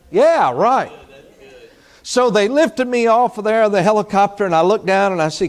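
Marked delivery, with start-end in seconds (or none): 0.1-0.9 sarcastic. Real, not acted. Sarcastic aside to himself.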